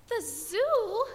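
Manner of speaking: a lady aghast at the news; miserable exclamation. A narrator's reading. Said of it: "Missus Prim exclaimed miserably".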